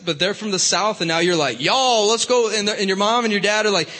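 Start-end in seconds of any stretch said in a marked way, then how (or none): 1.6-2.5 mock Southern twang; Southern accent. Teen copying a friend from the South, Southern accent. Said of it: "speak in a Southern accent".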